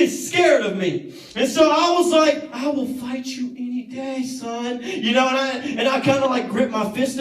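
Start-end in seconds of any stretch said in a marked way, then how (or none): 2.5-4.8 cocky bravado, a bit softer; mock tough guy. Kid bluffing a smaller bully, cocky and cool.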